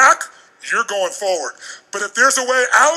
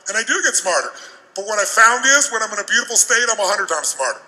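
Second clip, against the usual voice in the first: ordinary talk, nothing marked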